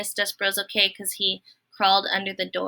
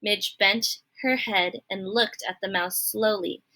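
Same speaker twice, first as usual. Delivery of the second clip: ordinary delivery, nothing marked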